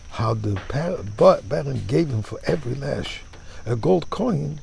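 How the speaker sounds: ordinary talk, nothing marked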